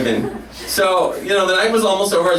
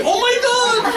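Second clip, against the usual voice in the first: very high-pitched ecstatic squeal; crowd going crazy. Retold or imitated. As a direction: mimic a wildly excited audience. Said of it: "The place goes crazy".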